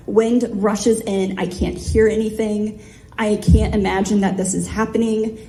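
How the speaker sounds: ordinary delivery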